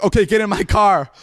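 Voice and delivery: loud, high retold command; urgent cop order. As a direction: imitate a cop hurrying a kid along. Cop urgently ordering him into car.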